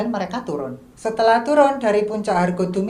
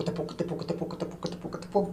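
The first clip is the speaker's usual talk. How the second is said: much softer, hushed running-step patter; soft footstep sounds; 4 semitones lower, 12 dB quieter, much faster than usual. Retold or imitated. (bunyi langkah lari pelan berbisik)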